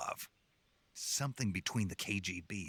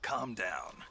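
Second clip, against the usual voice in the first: ordinary delivery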